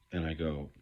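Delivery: normal talking voice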